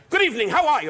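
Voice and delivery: loud, high booming greeting; loud mother. Retold or imitated. Mother greeting the gangsters, loud and booming. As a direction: boom it like through a megaphone. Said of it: "my mother was the type of person".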